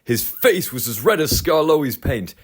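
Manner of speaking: plain reading voice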